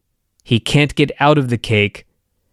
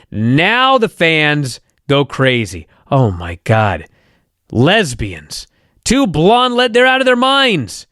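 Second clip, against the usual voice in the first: ordinary talk, nothing marked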